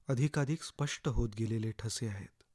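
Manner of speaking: plain reading voice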